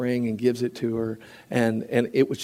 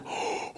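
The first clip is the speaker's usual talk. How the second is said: mock surprised gasp; 14 dB quieter than usual. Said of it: "I'm like".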